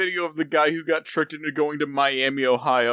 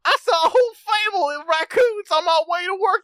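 very high, louder Southern twang impression; Southern coked-up Tim Allen; 5 dB louder than usual. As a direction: go wired and Southern. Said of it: "you start sounding like a coked up Tim Allen".

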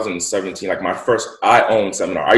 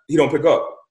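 normal talking voice

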